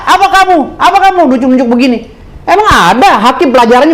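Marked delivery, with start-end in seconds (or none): 0.1-1.2 high, snapping mock judge. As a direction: sneer at the lawyer like a rude judge. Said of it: "Giliran sama jaksa baik sama kita". (menirukan hakim membentak dengan nada tinggi)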